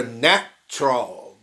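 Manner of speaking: "natural" in two separated beats, na-tral; two clear syllables in "natural", na. Shown as a demonstration. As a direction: drop the middle vowel of "natural". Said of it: "virou duas".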